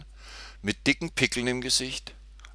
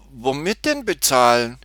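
mildly baffled, higher and louder; a girl's puzzled, raised-pitch question; astonished question; 6 semitones higher, 7 dB louder than usual. A narrator's reading. Tutti asks the cashier how to pay, mildly astonished. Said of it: "Tutti war erstaunt".

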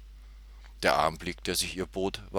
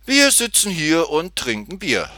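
man explains their pastime to Tutti, lightly sing-song higher voice; higher, louder line from a drinker; man explaining; 9 semitones higher, 7 dB louder than usual. A narrator's reading. Man answers the girl's question, lightly voiced and higher. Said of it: "erklärte er".

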